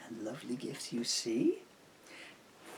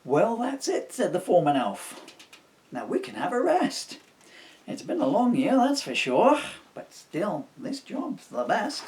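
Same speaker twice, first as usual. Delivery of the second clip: high loud chirpy elf; elf foreman voice; 8 semitones higher, 9 dB louder than usual. Acted. Foreman elf tells the elves they can rest, high and loud. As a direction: pipe up cheerfully like a tired elf.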